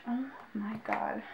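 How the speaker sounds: hushed oh my god under the breath; whispered awe. Real, not acted. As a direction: say it to yourself, amazed. Whispered awe at another item.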